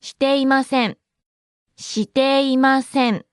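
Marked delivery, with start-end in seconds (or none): 1.8-3.2 every syllable lengthened and distinct. Shown as a demonstration. Slow repeat for learners.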